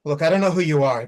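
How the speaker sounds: loud, snapping at the stranger; angry outburst. Acted. To the stranger who won't leave, a loud angry snap. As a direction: push him away with a loud warning. Stranger bothering her on bridge.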